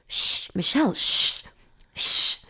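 sharp shushes round the name; urgent hushing. Retold or imitated. Friend silencing Michelle in class, urgent hushing.

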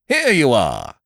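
high, loud hearty greeting; hearty cry. A narrator's reading. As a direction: call out in delight like a jolly squire. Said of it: "he cried".

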